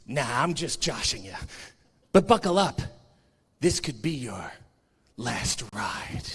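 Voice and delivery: low, soft, menacing Undertaker voice; low, hushed Undertaker voice, ending in a whisper; Undertaker impression. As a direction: growl it slow like the Dead Man. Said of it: "little scene from Undertaker One Dead Man Show".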